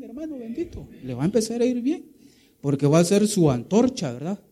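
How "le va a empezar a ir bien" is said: "Le va a empezar a ir bien" fast and high, "bien" rising; fervent promise. Acted. Preacher pressing the promise on the brother, fast and fervent. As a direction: rattle it off with rising fervor.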